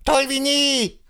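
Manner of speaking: bleating laugh into high praise; delighted billy goat. Acted. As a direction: gush with rising delight. Ziegenbock begeistert nach dem Singen. (begeistert meckernder Ziegenbock)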